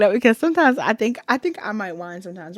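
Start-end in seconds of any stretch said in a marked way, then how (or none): none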